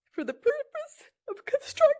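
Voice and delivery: real tears breaking the words; crying. Real, not acted. Aunt reading her support letter to the committee, sobbing. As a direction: voice cracks high into a sob. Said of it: "I'm a little emotional".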